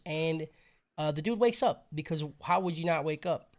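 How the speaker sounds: usual speaking voice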